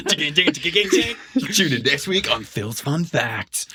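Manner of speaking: high, drawn-out announcer tag; show sign-off. Pretend. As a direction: stretch it out like a jingle outro. Mock show sign-off, jingle.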